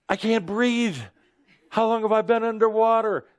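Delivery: hammed-up panic of running out of air; mock panic. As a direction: gasp it out in sudden panic. Acting sudden panic underwater.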